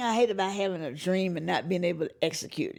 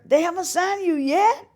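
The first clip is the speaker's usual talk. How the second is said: high, louder taunting husband voice; mocking husband; 11 semitones higher, 8 dB louder than usual. Husband teasing wife about her record deal, raised mocking voice.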